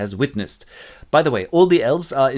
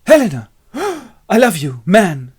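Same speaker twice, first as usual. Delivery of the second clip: loud, higher gasp of adoration; love-struck; 7 semitones higher, 9 dB louder than usual. Acted. Lysander waking under the spell, love-struck. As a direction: gasp and declare love loudly. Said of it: "He wakes up and goes".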